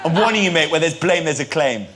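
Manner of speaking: ordinary delivery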